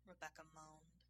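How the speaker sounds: plain reading voice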